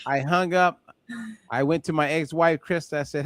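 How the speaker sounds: ordinary delivery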